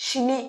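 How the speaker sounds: সিনে with the final e voiced; mistaken pronunciation demo. Shown as a demonstration. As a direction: say সিনে as two syllables, 'shi-ney'. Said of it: "ই, ই কি বলবো আমি?".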